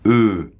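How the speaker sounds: lips round as for O, sound E; French schwa e. Shown as a demonstration. Teacher modelling the final "E" for learners. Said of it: "bien prononcer le E final comme ça".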